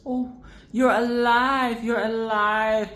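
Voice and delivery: overjoyed at a friend alive; hammed-up delight; mock relieved joy. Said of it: "because he said".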